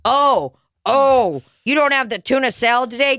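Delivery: high, put-out customer voice; mock dismay from a customer. Mocking a disappointed customer.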